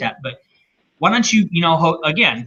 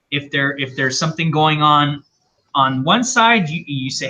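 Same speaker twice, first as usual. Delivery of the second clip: ordinary delivery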